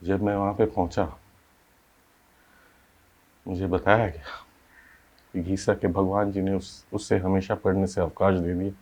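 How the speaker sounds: hushed, heavy-hearted recollection; subdued grief. Acted. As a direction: let the voice sink quietly with sorrow. Telling of the child's death.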